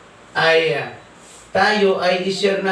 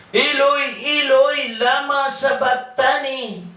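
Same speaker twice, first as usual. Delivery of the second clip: raised pitch, restrained crying out; drawn-out, higher-pitched imitation of a cry; mild retold cry; 7 semitones higher, slower than usual. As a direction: call it out higher, without really shouting. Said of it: "sumigaw siya ng malakas".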